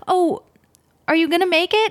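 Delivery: mock innocent grandma. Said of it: "And she goes".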